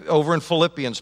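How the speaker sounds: ordinary talk, nothing marked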